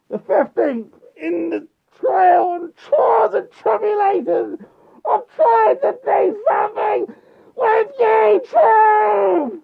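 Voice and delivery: high, loud hammed-up wail; mock wailing. Greeting his viewers with a high hammed-up despairing wail. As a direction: wail the intro like a tragic hero.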